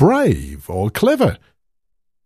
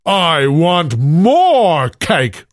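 louder, slow demanding roar; giant roar; 5 semitones higher, 5 dB louder, slower than usual. A narrator's reading. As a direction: roar it slowly and greedily. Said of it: "roared the giant".

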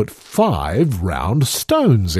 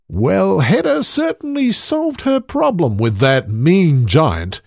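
plain reading voice

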